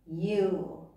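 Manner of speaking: ordinary delivery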